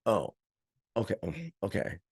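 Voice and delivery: muttered, unconvinced oh okay, soft and quick; mock doubtful. Friend unconvinced by his story, muttering disbelief. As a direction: brush it off like you still don't buy it.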